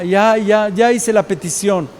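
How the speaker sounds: usual speaking voice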